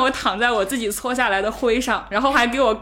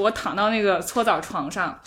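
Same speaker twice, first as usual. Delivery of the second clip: ordinary delivery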